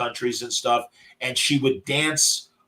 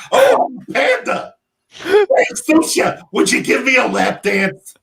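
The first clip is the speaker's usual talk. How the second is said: much higher, louder cartoon panda pleading for a lap dance; scary panda voice; 11 semitones higher, 5 dB louder than usual. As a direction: squeal it out like a creepy mascot. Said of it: "the very scary".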